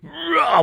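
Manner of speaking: high strained effort shout; straining cry. Real, not acted. Straining again, higher.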